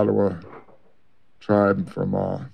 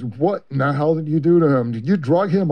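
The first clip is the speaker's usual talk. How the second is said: angry outburst; 7 semitones higher than usual. Retold or imitated. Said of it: "and Tom goes".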